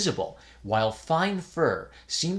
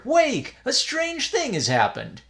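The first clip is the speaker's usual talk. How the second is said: high, loud urgent wake-up call; 12 semitones higher, 4 dB louder than usual. A narrator's reading. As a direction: shake him awake urgently. Urgently waking the priest.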